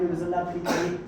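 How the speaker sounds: ordinary delivery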